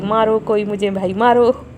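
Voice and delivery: quoting the daughter amid giggles; mock plea tossed out laughing. Mother quotes daughter's playful plea, laughing.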